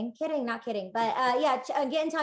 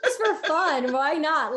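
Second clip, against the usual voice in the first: louder, amused through laughter; real laughter; 4 semitones higher, 5 dB louder than usual. Real, not acted. Guest egging host on to play along, laughing. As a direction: laugh while urging playfully. Laughing together over playful suggestion.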